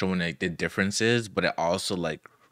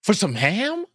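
high, loud disbelief; can't believe the price; shocked yelp over a ham; incredulous; 12 semitones higher, 5 dB louder, slower than usual. Real, not acted.

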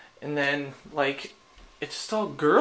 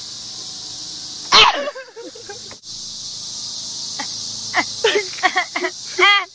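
a long helpless laughing fit; long high laugh; 4 dB louder than usual. Real, not acted.

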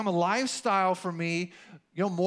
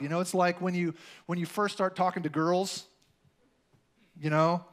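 ordinary delivery

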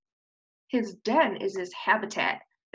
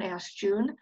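usual speaking voice

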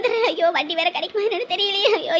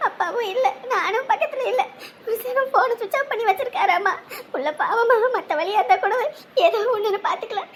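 tearful, helpless lament; weeping mother in despair; crying. Acted. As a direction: lament through tears for a daughter. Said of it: "இப்ப எதுக்கு அழுதுகிட்டு இருக்க".

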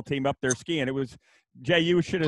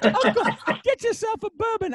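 put-on much higher gruff old-coach voice, laughing through it; Joe Paterno laughing; 4 dB louder than usual. Storyteller laughing inside his old-coach impression. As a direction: crack up while staying in the old-coach voice. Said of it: "He goes".

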